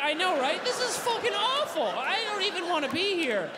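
mortified and whiny; squatting dog cringing with shame; ashamed dog. Acted. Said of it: "they're like".

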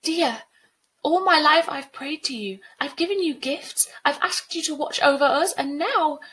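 high, loud, reproachful pleading; impassioned plea. Acted. Girl reproaching the spirit she prayed to, high impassioned plea. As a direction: plead with mounting indignation. Sabrina pleads angrily with Dia.

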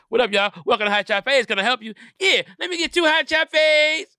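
hammed-up counter banter; mock restaurant greeter. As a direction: hype up the new lamb chop spot. Acting restaurant worker and customer.

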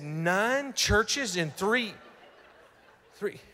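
slow, dragged-out astonished repeat; stunned disbelief. Retold or imitated. Pastor echoes the woman's words in slow disbelief. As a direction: let the number sink in slowly.

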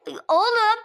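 usual speaking voice

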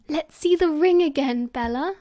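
pleading. A narrator's reading. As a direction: coax an elder sister, voice lifted.

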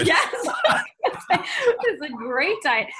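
high, loud, laughing words; laughing agreement. Real, not acted. Sharing the host's poverty-diet joke, laughing. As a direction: agree through real laughter, drawing it out.

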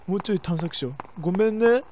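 ordinary talk

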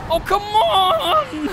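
long drawn-out crying wail; anguished wail. Real, not acted. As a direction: wail it in despair. Despair at game death.

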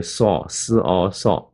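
"saw，s o saw" slowly, sound by sound; sounded-out ending. Shown as a demonstration. Teacher drilling the Arkansas ending, "saw" slowly sounded out. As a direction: build s and o into "saw". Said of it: "后面就是saw".

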